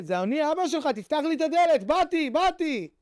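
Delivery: high, pleading plea at the door, slowing at the end; crying father. Retold or imitated. Father begging his son to open the door, high tearful plea. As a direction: beg through the door, voice lifted and stretched. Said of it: "בוכה".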